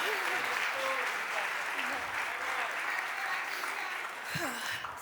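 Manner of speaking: long, high, shaky sigh while close to tears; a real tearful exhale, trying to steady herself. Real, not acted. Speaker overcome with emotion, pausing.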